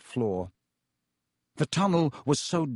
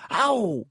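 high, sharp "ow" of pain; sudden high yelp; loud yelp; 8 semitones higher, 4 dB louder than usual. A narrator's reading. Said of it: "a loud".

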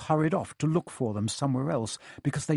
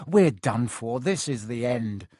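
usual speaking voice

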